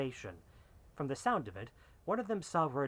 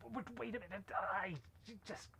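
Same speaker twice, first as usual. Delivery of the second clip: much softer, high, stammering plea; scared pleading; 6 semitones higher, 10 dB quieter than usual. Acted. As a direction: beg for your life in a frightened stammer. Wounded man begging, scared.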